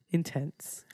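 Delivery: whispered, sheepish aside; soft voiceless confession after a laugh; hushed admission. Real, not acted. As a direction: drop to a near whisper. Mock-confiding admission after a laugh.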